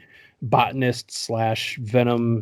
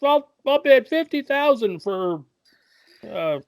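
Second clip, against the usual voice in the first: high-pitched, hammed-up bidder voice; mock rich man bidding; rich auction bidder; 11 semitones higher, 4 dB louder, slower than usual. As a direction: call out a bid in a silly high voice. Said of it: "these rich guys going".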